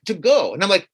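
ordinary delivery

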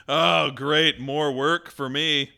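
loud, sarcastic complaint, slowing at the end; sarcastic groan. Real, not acted. Co-host mocks the doll manual with loud sarcasm. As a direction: grumble loudly at the extra chore.

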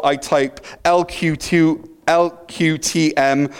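ordinary delivery

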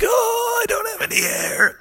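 high, strained panicky cry; out of air. Acted. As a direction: choke it out as if suffocating. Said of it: "he tried to keep singing".

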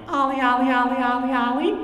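loud, very high cartoon pet call; cute Mickey voice. Calling his new puppy, loud cute cartoon voice. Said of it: "my cute voice is Mickey".

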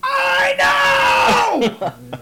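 high, drawn-out yell; retold full-volume scream. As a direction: scream it long at the top of your range. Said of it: "screamed as loud as I could".

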